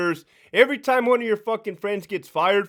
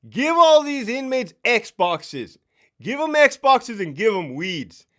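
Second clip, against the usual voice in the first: slow, high, loud decree; mock proclamation; 5 semitones higher, 5 dB louder, slower than usual. Sergeant proposing Xboxes to inmates, mock proclamation. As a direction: announce it like a bold new policy. Ex-sergeant pitching a bold idea.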